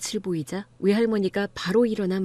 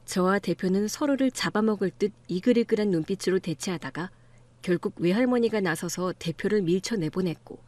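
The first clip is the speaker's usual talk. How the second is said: ordinary delivery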